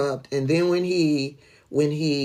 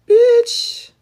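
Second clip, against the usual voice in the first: high, loud yelled Bitch; a high, loud yelled Bitch at Suzanne; sudden shout; 6 dB louder than usual. Real, not acted. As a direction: blurt it out as a sharp, high outburst. Calling out a woman online.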